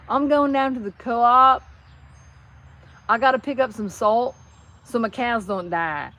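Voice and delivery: put-on Tennessee accent. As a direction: mimic a Tennessee neighbor, stretching the vowels. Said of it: "that's only a Tennessee thing".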